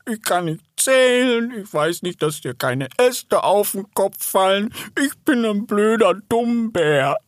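high, drawn-out whimpering bear; tearful. A narrator's reading. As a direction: wail it slowly, close to crying. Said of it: "fing an zu weinen". (weinerlich jammernd, hoch und gedehnt)

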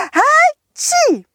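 a big sneeze from a tiny snowman; loud high sneeze. Acted. (lautes, hohes Niesen)

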